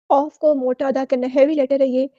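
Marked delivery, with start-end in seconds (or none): none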